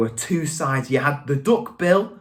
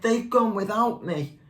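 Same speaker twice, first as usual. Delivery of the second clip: mildly plaintive, higher wail; crying out; 5 semitones higher than usual. A narrator's reading. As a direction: lament it lightly as a left-out youngster. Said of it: "And he cried".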